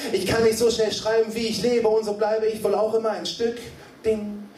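high, driving spoken word; insistent rhythmic recitation; urgent slam poetry. Acted. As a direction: hurtle through the lines breathlessly on the beat.

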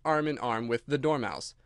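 ordinary delivery

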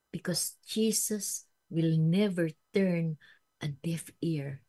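usual speaking voice

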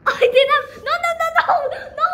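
loud high wail into rapid no's; alarmed outcry. Real, not acted. Child as the balloon goes wrong, alarmed high cries. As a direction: cry out in sudden dismay.

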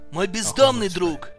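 ordinary talk, nothing marked

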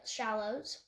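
ordinary delivery

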